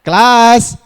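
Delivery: loud, high classroom shout; attention call. Shown as a demonstration. Trainer shows how to summon a class, loud call. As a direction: call out to the whole room.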